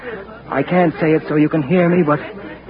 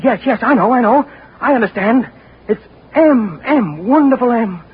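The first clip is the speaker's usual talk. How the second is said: eager excitement; 7 semitones higher, 3 dB louder than usual. Acted. Eagerly grasping the letters traced on him.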